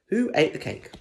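high, dramatic title read. A narrator's reading. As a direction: announce it like a mystery.

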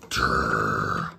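very low rolling trill; long, low imitated rumble; trilled noise. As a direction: roll out a low "turr". Spelt story sound effect.

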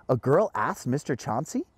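usual speaking voice

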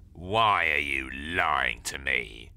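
low, slow, loud nasal menace; menacing neighbour. Acted. Nosy neighbour accusing the boy, low, slow and nasal. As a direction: lean in slowly and accuse.

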